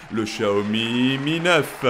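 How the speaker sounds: slow drawn-out ring announcer call. Acted. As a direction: stretch the name out like a boxing announcer. Boxing-style contender introduction.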